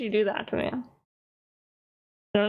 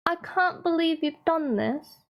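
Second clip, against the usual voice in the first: higher-pitched put-on British accent; hammed-up, badly faked British reproach; 7 semitones higher than usual. Scolding G-Mac over the spreadsheet, bad British accent. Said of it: "my British accent's really bad".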